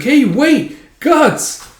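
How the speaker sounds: high, loud, squeaky shout; acted high-pitched call; calling after someone. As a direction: call him back urgently as a little elf. Puck calls after Guts.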